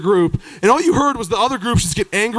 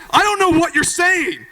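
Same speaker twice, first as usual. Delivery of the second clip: baffled; 8 semitones higher than usual. Retold or imitated. Comedian baffled by mumbling.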